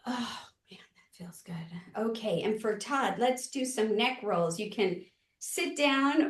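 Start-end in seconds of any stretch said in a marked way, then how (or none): 0.1-1.6 breathy whispered relief; whispering. Real, not acted. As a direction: breathe the words out softly. Relieved sigh during neck stretch.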